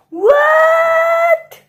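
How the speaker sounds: very high, very loud drawn-out cry; shocked shriek. Acted. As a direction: shriek it out in utter disbelief. Prince shocked at becoming king.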